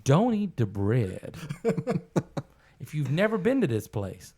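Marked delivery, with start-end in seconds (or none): 0.0-1.4 1 stern warning to the customer. Retold or imitated. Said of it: "pulls it back and goes".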